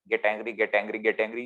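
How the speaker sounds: low, soft hissing coax of a snake-like mind; mind's quiet goading whisper-like urge; tempting mind voice. Retold or imitated.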